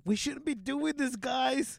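high mock-cautious goody-goody voice; wet towel kid. Kid warning friends in the stolen car, high mock-cautious voice. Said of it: "like, the wet towel".

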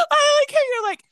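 crying with laughter, high and loud; high laughing squeal. Real, not acted. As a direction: squeal it through tears of laughter. Laughing at her friend.

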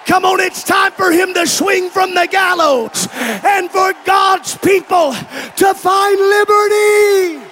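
loud climactic preacher's shout; preaching. Acted. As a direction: hit the peak of the sermon.

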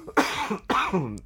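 sudden harsh cough; coughing fit. Real, not acted. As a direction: cough hard mid-sentence. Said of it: "I'm gonna be coughing a lot".

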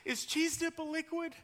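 high, mock-trembling question. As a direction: fake a shaky worried plea. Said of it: "with trembling in his voice".

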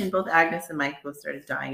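ordinary delivery

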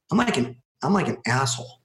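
ordinary talk

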